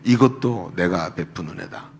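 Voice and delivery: low, slow, solemn divine voice; voice of God. Retold or imitated. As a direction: speak it slowly and low, as God. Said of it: "마음속에 얘기하시는 거예요". (낮고 느린 하나님의 음성)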